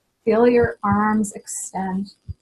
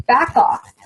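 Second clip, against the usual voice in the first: louder, firm command; emphatic; 3 semitones higher, 6 dB louder than usual. Shown as a demonstration. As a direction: warn them off sharply. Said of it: "much more emphatic".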